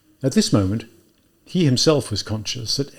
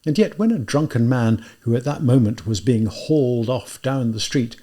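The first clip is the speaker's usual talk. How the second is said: usual speaking voice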